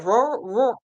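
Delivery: "Rural" tried twice with blurred r's and l; tongue-tied attempt. Real, not acted. Crafter retrying a word they can't say, tongue-tied. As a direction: garble "rural, rural" as one swallowed sound. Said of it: "I can't say that word".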